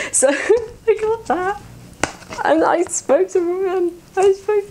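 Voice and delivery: high, giddy and slow, near squealing; giddy excitement. Real, not acted. Fan gushing about meeting the voice actor, giddy and high. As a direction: gush about meeting your hero.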